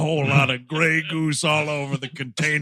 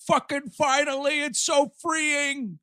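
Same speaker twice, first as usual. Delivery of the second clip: high, mock Scotty voice cursing out Kirk; ranting dying Scotty; 10 semitones higher than usual. Scotty telling Kirk off before he dies. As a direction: let loose like a man with minutes left.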